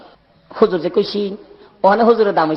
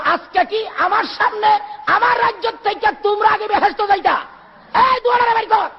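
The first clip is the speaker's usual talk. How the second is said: high, stretched-out outrage; indignant king; 14 semitones higher than usual. Acted. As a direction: rise to a shouted royal order. King's acted outrage, orders them out. (উঁচু গলায় ক্ষুব্ধ বাদশাহি হুকুম)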